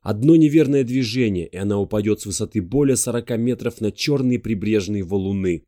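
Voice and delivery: ordinary delivery, nothing marked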